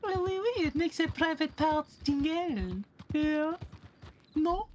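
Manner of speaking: put-on high, hammy French accent. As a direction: lay on a thick French accent. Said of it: "Maybe not the way I say it".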